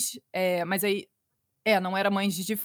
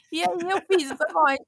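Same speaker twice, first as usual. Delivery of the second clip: usual speaking voice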